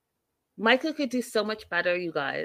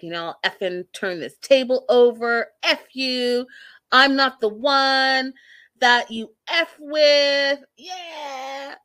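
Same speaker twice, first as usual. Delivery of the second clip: louder, higher, drawn-out defiance; hammed-up furious tirade; mock freak-out; 5 semitones higher, 6 dB louder, slower than usual. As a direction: imitate her ranting, stretching the words out. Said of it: "Angela starts freaking out".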